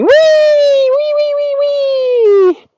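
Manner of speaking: very high, loud joyful wee-ing; gleeful high squeal. Pretend. Rider squealing with glee down the trail. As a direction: squeal like a kid running downhill. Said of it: "Running down the trail screaming".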